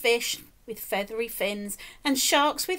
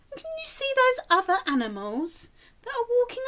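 excited question; 11 semitones higher, faster than usual. Real, not acted. Reader excited to child.

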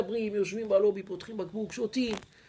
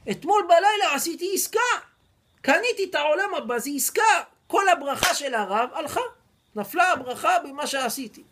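much higher and louder, alarmed; panicked dismay; 7 semitones higher, 6 dB louder than usual. Acted. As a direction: realize the blessing is lost. Buyer panics over lost blessing.

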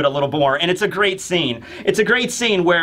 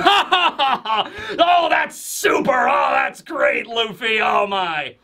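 busting out laughing, high delighted shout; hammed-up guffaw into big high exclamations; Frankie; 6 semitones higher, much slower than usual. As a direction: crow with laughter, easing off at the end. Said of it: "busting out laughing".

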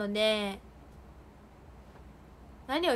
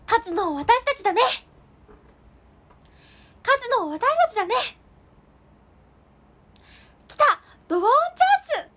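very high, loud game-character voice; archangel impression; 11 semitones higher, 8 dB louder than usual. Imitating a card-game character for viewers, high loud cries. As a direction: call it out high like an animated game heroine. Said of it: "大天使のモノマネ。".

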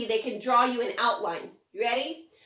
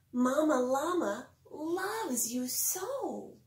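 tender; 5 dB quieter, much slower than usual. A narrator's reading. Reassuring line in bedtime story.

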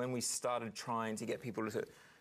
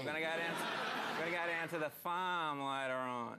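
broad Queensland accent; 5 semitones higher than usual. Shown as a demonstration. Queenslander telling a mate the farm plans, broad Queensland accent. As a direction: lay the Queensland accent on thick. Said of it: "he's got a really broad kind of accent".

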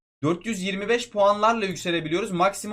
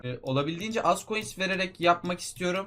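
ordinary talk

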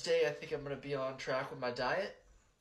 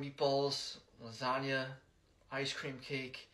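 normal talking voice

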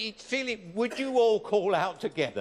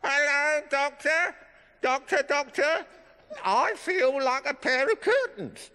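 squeaky ventriloquist dummy; 7 semitones higher than usual. Acted. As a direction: play the cheeky dummy telling a joke. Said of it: "he's gonna tell us a few jokes".

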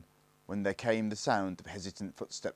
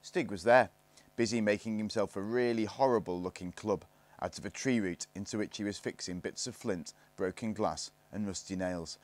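plain reading voice

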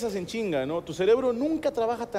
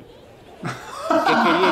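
puzzled; 5 dB louder, much slower than usual. Retold or imitated. Forgetful pause acted out.

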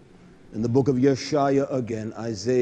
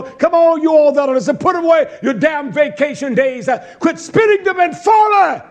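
high, loud preacher's shout; full-force preaching; 11 semitones higher, 8 dB louder than usual. Real, not acted. Preacher rebukes listeners over vacation days, full-force preaching.

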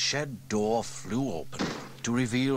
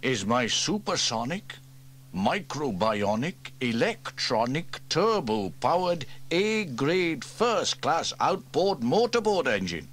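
building, louder proud list; proud grand build; 7 semitones higher, 5 dB louder, slower than usual. Acted. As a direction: build each word grander. Proud listing of grand names.

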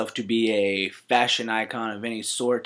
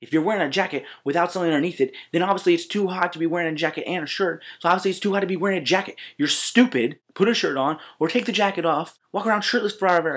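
mean and spiteful; 7 semitones higher, faster than usual. Real, not acted. Ranting insults at shirtless guys.

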